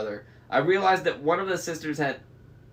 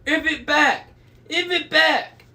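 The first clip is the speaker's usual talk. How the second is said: high, loud furious demand; yelling; 13 semitones higher, 6 dB louder than usual. A narrator's reading. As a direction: yell it at the thief, pitched way up.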